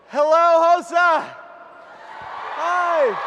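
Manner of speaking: high, loud stage greeting; calling out. Real, not acted. [name] greeting a packed audience on stage, calling out. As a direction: shout hello out to a big crowd.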